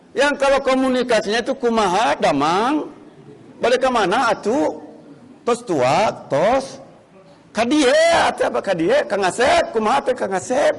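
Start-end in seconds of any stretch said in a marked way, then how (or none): 1.6-10.8 Sundanese speech. Retold or imitated. Preacher imitates a Sundanese greeting a friend, lilting. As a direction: gently sing out the Sundanese greetings. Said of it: "Itu bahasa orang Sunda".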